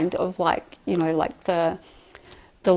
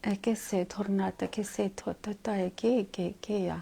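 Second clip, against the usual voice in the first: whispered channeled syllables; 9 dB quieter than usual. Acted. Channeler whispering the beings' language.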